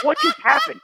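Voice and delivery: high, incredulous gasp of a question; stunned disbelief. Retold or imitated. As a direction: sound floored by the price. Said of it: "I'm like".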